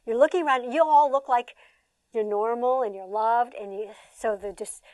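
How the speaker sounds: ordinary delivery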